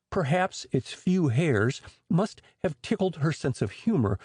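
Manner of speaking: usual speaking voice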